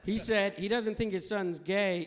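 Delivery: ordinary talk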